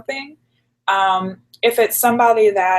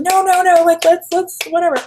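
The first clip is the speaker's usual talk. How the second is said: Jackie insisting; 11 semitones higher, 6 dB louder than usual. Retold or imitated. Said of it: "She's like".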